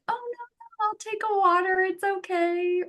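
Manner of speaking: high, soft, uneasy decline; awkward polite refusal. Acted. Guest turning down the host's drink, awkward high soft voice. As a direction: shrink back into a tiny high voice. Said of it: "That kind of feels awkward".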